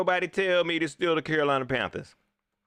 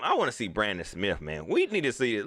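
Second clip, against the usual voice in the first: ordinary talk, nothing marked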